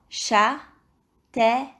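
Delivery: châtaigne. in three separated syllables, final gne sounded; measured châtaigne., a gap after each syllable. Shown as a demonstration. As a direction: break "châtaigne." into châ, tai, gne. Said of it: "taper les syllabes".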